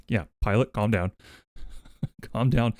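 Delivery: ordinary talk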